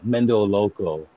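ordinary delivery

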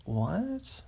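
normal talking voice

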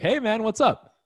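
high-pitched, breezy greeting; casual hello, lifted high; oblivious casual caller. Acted. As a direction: pitch it up like an unsuspecting caller saying hi. Voices an unsuspecting cold caller's greeting.

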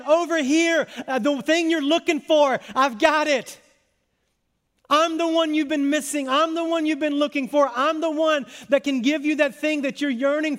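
high, insistent crowd-reaching call. Acted. Jesus to the weary in a crowd, calling out. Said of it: "He's calling out to them".

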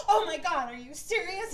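imitating Heather's dramatic shock on the phone; mock shocked gasp. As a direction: act out a dramatic phone shock, half-joking.

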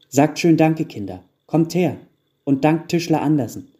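lightly voiced mother rounding up children; prompting mother. A narrator's reading. Mother telling children to thank the carpenter, prompting.

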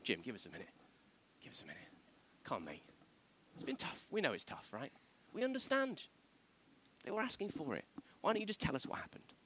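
hushed, confiding, part-whispered sympathy; good cop. Acted. Good cop coaxing a suspect to confess, hushed and sympathetic. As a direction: drop to a soft, understanding near-whisper. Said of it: "But then the other cop says".

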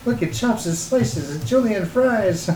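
lightly voiced TV salesman; infomercial pitchman. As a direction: pitch it like a late-night ad. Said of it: "Slap chop".

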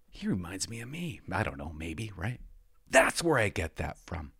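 softly acted grandkid thinking aloud; quiet musing. Grandkid watching old videos of a relative, quiet acted musing. Imagines descendants reacting to his videos.

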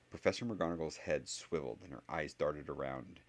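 usual speaking voice

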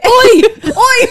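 loud high British Oi; British accent shout. Acted. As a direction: bark it like a Brit hailing a mate. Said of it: "That's British".

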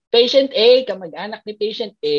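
calling out a name across the ER, high and loud; retold call out. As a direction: shout for the patient's relative. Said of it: "sumigaw ako". (tumatawag nang malakas sa pasyente)

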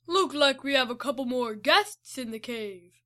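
villain voice. Acted. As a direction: savour each word like a gloating villain. Fraser speaking.

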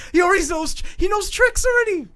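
high, loud burst of delight; excited gushing. Retold or imitated. Kid at the kennel seeing a dog do tricks, excited gushing. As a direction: gush over a clever puppy.

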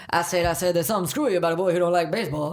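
fast Southern rooster bluster, lightly voiced; Foghorn Leghorn accent. Rooster mocking the egghead boy, fast Southern Foghorn accent. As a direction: spout it quick in Foghorn Leghorn's Southern twang. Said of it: "he's like (Foghorn)".

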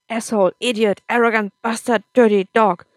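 loud string of angry insults; shouted abuse, building in volume; shouting. A narrator's reading. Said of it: "Akam shouted and ran into his room".

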